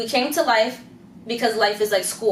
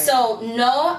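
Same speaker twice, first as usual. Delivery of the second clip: ordinary delivery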